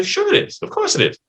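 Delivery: sarcastic client brushing off the therapist. Retold or imitated. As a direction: drip sarcasm, humoring them. Client mocking therapist's claim.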